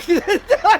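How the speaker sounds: loud high burst of laughter; laughing at the imagined friend. Real, not acted. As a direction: crack up loudly. Laughing at his own joke.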